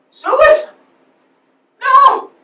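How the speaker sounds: high, loud cries of grief, fading; anguished shout. Acted. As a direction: scream his name in disbelief. Learns partner's suicide, cries out.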